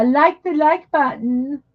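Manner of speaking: put-on half-hearted Valley Girl uptalk; Valley Girl accent, stretched and singsong. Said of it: "I can't do a Valley Girl".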